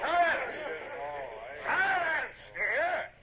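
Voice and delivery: sharp, high shouted command for silence; angry shout. Acted. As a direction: cut the cheering dead. Captain silences cheering crew.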